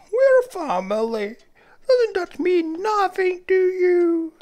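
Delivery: hurt high indignant voice. Acted.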